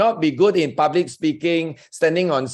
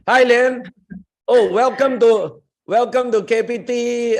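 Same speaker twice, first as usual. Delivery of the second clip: excited welcome; 6 semitones higher than usual. Real, not acted. Host welcoming Lian to the Zoom group, excited. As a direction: hail a newcomer with delight. Greeting a newcomer spotted on Zoom.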